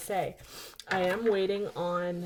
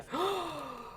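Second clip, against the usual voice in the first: shocked gasp; 10 semitones higher, 5 dB quieter than usual. Real, not acted. Finds cut pages in book.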